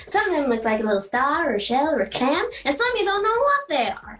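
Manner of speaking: a hint of a brassy gossip going off about belly buttons; Roseanne Roseannadanna impression. Doing an SNL impression for the interviewer, lightly brash accent. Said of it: "I'll do an impression".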